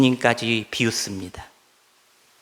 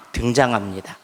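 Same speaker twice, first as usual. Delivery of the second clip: usual speaking voice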